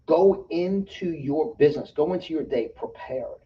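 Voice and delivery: slow emphatic advice. Acted. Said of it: "speaker coaching the audience".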